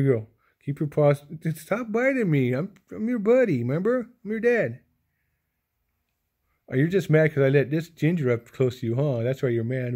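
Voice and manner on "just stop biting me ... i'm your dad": cooing plea. Real, not acted. Owner pleads with the biting cat in high baby talk. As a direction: coax the biting cat sweetly.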